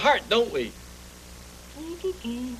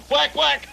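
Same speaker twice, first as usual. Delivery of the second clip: playful whack whack; 10 semitones higher, 6 dB louder than usual. Acted. Playful noise.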